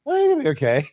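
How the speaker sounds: squeaky high mocking dad voice; mock a carefree dad with a high, squeaky voice; mock cheery dad.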